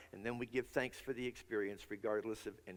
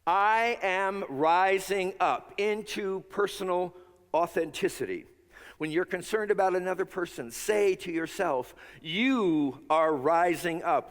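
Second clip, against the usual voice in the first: full-force preaching; 5 semitones higher, 9 dB louder than usual. Acted. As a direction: proclaim it so the whole room joins in. Preacher leads congregation in affirmation.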